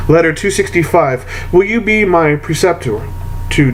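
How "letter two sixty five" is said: usual speaking voice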